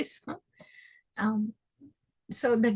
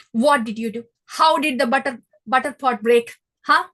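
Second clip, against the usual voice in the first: mock stern mother; 7 dB louder than usual. Yashoda questioning about butter pot.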